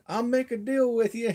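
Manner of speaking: re-enacted high holler; calling out. Hollering back to mom.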